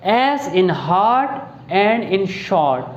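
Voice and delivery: hard and short drawn out very slowly on the long o sound; slow vowel demo. Shown as a demonstration. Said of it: "अगले vowel की तरफ जो कि है आओ".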